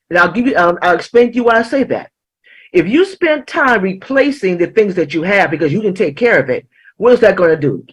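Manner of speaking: ordinary delivery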